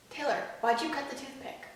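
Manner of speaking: ordinary delivery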